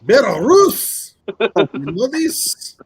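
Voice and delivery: drawn-out mock Russian accent; hammed-up Russian accent questioning a friend. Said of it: "you sound more Belarus".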